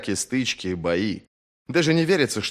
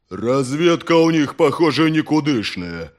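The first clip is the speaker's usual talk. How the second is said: rumbling voice; 5 semitones higher, 5 dB louder than usual. Acted. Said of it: "пророкотал Тулдуру".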